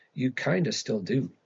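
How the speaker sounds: plain reading voice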